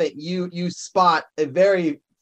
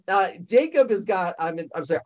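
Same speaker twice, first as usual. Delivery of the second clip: ordinary talk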